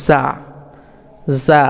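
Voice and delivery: জা as a z sound with long aa; demonstrated letter sound. Shown as a demonstration. Teacher showing how to pronounce the letter, long জা. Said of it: "এটা আমরা উচ্চারণ করব".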